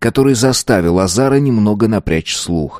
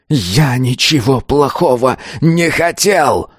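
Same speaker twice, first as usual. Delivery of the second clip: slow, higher strained protest; anguished cry; 5 semitones higher, slower than usual. A narrator's reading. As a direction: cry it out slowly in despair. Pupil's anguished cry while attacking. (отчаянно, протяжно и выше)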